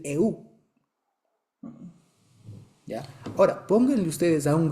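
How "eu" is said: Eu drawn slowly as e-u, both vowels heard; Spanish vowel pair. Shown as a demonstration. Teacher showing the impossible sound for gringos, Eu as e-u. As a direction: sound out Eu as e then u. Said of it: "No pueden decir".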